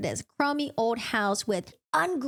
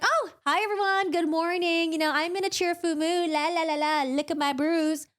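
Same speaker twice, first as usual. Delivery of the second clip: fake cheerful; 6 semitones higher than usual. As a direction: chirp it out like a falsely sunny greeting. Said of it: "she's like".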